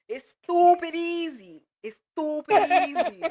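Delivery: hammed-up New Yorker, stretching each word; New Yorker accent. Shown as a demonstration. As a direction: put on a loud, slow New York accent. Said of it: "I'ma do my New Yorker".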